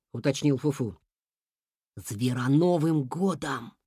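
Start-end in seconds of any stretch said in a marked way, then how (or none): 2.0-3.7 slow, hushed, ominous answer; mysterious. A narrator's reading. Rabbit answering what would crush them, mysterious. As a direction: draw it out like a secret. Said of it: "загадочно ответил кролик".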